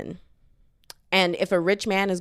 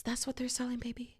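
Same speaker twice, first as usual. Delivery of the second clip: whispered aside; 3 semitones higher, 13 dB quieter than usual. Acted. Sly aside to viewer.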